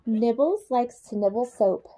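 ordinary delivery

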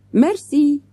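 light French thanks; French accent. Acted. Waiter thanks manager.

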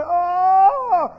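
loud, high drawn-out moan; imitated crying-out moan; imitated wail. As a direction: wail like someone overcome in prayer. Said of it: "all she's doing is going".

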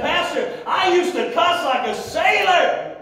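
high, drawn-out pulpit retelling; emphatic retold confession. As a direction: preach her confession out to the crowd. Same confession retold, now preached loudly.

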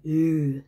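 loud, mock disgusted "ew". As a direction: blurt an imitated noise of disgust. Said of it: "looking up and going".

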